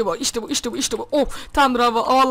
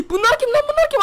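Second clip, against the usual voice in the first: startled fear; 10 semitones higher, 3 dB louder than usual. Real, not acted. Kapıyı açınca korkuyor.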